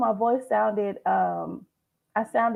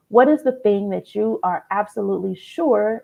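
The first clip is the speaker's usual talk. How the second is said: ordinary talk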